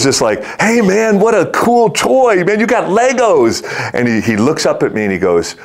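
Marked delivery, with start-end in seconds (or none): 0.6-3.6 put-on high-pitched, gushing enthusiasm to a kid; excited cheer. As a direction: gush over the child's new toy, much higher. Cheering a kid about his toy.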